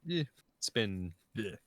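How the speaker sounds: ordinary talk, nothing marked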